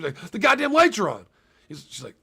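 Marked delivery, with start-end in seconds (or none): 0.3-1.3 irritated outburst at the lights; angry exclamation. Retold or imitated. Sleepless husband complaining to therapist, higher, louder.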